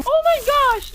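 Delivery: high-pitched cry of disbelief; exasperated shriek. Acted. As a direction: squeal in hammed-up exasperation. Exasperated at friend's grenade blunder. (inis na patiling bulalas)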